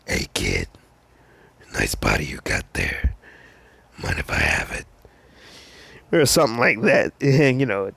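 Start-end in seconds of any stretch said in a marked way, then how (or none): 0.1-4.9 Venom. Acted. Sludge villain to a kid he wants, menacing Venom voice. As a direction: rasp it out deep and menacing. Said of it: "do it in my Venom voice".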